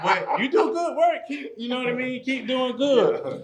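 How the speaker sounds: very high laughing lady voice, slowing at the end; mock client lady, laughing. Client lady praising the trainer's work, high laughing voice.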